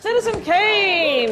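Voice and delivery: high, loud, drawn-out cheer; triumphant confirmation. Real, not acted. Quiz host confirming the film the team finally found, excited shout. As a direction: stretch out the answer in jubilation.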